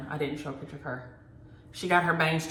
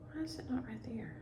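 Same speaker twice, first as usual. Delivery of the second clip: whisper; 4 semitones higher, 16 dB quieter than usual. Real, not acted. Searching phone, talking to herself.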